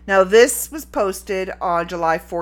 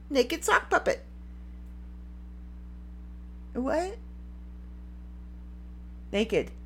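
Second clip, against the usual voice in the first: ordinary talk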